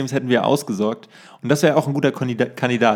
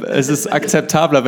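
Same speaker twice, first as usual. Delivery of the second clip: ordinary delivery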